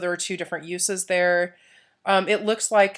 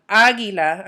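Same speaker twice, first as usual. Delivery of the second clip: águila, first A punched louder; stress on first syllable; 5 dB louder than usual. Shown as a demonstration. Teacher showing where accent sits, águila stressed on first A. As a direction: hit the opening A of águila hard. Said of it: "I'm putting the accent on the, that first A".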